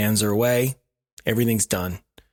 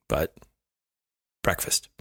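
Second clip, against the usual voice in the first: usual speaking voice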